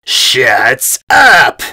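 loud crying yell; angry tearful shout; furious shout. A narrator's reading. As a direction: scream it at them. Invader yells in anger.

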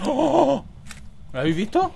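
loud startled cry; shouting in shock; panicking gamer. Real, not acted. Ghost appears suddenly.

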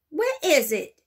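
eager shout. Acted. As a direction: shout it over the crowd. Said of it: "shouted somebody".

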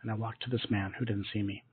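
normal talking voice

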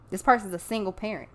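ordinary delivery

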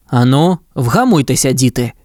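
mildly gruff fatherly order; firm, understated command to settle down; stern scolding. A narrator's reading. Dog father Pongo telling pups off, stern scolding. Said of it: "гримнув Понго".

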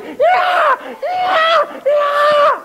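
high shrieking monkey yells; furious monkey. Retold or imitated. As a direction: scream like an enraged monkey. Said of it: "Monkey B is taking the cucumbers going".

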